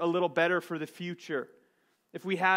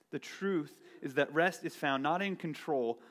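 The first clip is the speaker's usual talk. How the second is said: ordinary talk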